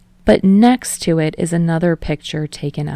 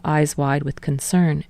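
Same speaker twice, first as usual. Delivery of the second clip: ordinary delivery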